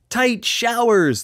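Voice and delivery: very high, slow blurt. A narrator's reading. Tall clown blurting the new chant, high excited blurt. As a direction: burst out with the discovery. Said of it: "the clown blurted".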